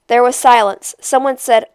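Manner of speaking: plain reading voice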